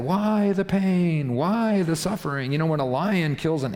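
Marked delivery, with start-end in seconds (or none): 0.0-2.5 high, drawn-out mock wail; mock upset. Humans protesting their suffering, hammed-up upset. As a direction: overplay the anguish, slow and raised. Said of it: "that get all upset".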